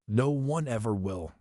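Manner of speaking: ordinary delivery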